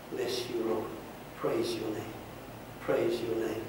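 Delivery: soft, hushed prayer of blessing; quiet closing prayer. Acted. Pastor quietly blessing the congregation for the new year. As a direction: pray softly, letting it settle.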